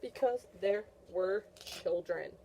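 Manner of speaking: ordinary talk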